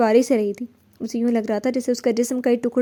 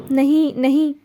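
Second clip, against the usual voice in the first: anguished cry of no, louder; 3 semitones higher, 4 dB louder, much slower than usual. A narrator's reading. Ahana screaming at the gunshot sounds, loud cry. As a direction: shout it out, covering her ears. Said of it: "زور سے چلا اٹھی".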